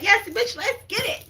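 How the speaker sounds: high, excited cheer; hyped-up quote. Retold or imitated. Quirky friend hyping the group, high excited cheer. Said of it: "You're like, be like".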